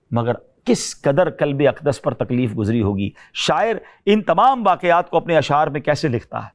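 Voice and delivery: ordinary delivery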